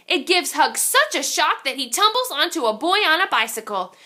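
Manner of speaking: ordinary delivery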